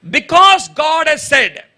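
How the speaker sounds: "Because God has said" stretched out, high and emphatic; emphatic scripture line. Real, not acted. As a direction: slowly underline every word of "Because God has said". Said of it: "underline the word".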